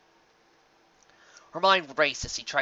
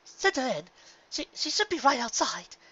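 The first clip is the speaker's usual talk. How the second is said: higher, urgent, stumbling; worried and pressing; insistent Rarity; 12 semitones higher than usual. A narrator's reading. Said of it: "growing more and more concerned".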